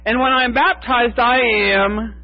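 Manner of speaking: high voice with baptized stressed, falling firmly on the last I am; lightly voiced woman stating it with certainty; a hint of a woman proudly declaring it; Fayette answering herself. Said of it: "Fayette says".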